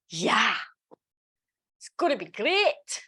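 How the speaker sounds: playful mock Scottish accent. Teacher teasing about an absent Sarah, mock Scottish accent. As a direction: slip briefly into a jokey Scottish accent. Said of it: "wanna do a Scottish accent".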